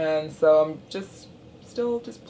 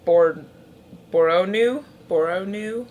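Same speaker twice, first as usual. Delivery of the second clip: unsure pronunciation; 4 dB louder than usual. Real, not acted. Unsure of a shade's name.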